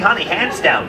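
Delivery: ordinary talk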